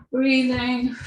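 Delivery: usual speaking voice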